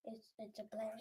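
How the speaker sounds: unvoiced whisper. Real, not acted. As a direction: whisper it to the side. Whispered aside.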